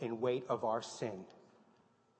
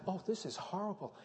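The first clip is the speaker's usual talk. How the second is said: high, hushed horrified voice; a viewer's breathless dismay; horrified gasp; 7 semitones higher, 4 dB quieter than usual. Retold or imitated. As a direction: sound appalled, pitched way up. Imagined viewer reaction again.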